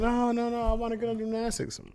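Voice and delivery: put-on high, pleading little girl; whiny child voice. Daughter begging dad to go to gymnastics, high whine. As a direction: beg like a toddler.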